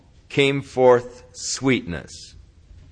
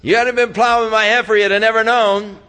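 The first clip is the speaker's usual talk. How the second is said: louder, higher, faster, an angry husband; angry outburst; 10 semitones higher, 6 dB louder, faster than usual. Acted. Samson to the wedding guests, angry shout. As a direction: spit it out like a betrayed groom.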